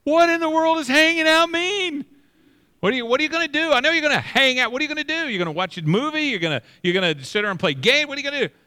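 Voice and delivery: high, loud mock-baffled questioning; exasperated dad. Dad demanding to know the kids' plans, mock-exasperated.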